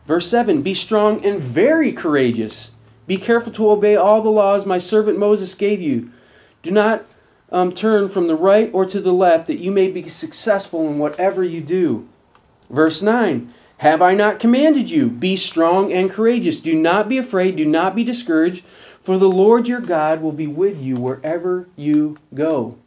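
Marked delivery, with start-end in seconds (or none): 0.6-12.1 a touch louder, earnest Bible reading; firm, rising scripture read. Acted. Reads scripture aloud. 13.8-22.7 louder, commanding Bible reading; preacher reading God's words with force; scripture reading. Acted. Reads scripture aloud.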